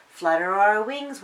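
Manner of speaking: usual speaking voice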